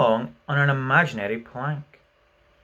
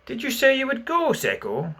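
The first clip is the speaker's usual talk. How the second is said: musingly; 12 semitones higher than usual. A narrator's reading. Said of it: "he said musingly".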